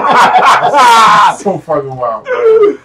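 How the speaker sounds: loud, drawn-out words through laughing; real laughter. Real, not acted. Friend reacting to the absurd bill, laughing loudly. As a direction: crack up while saying it.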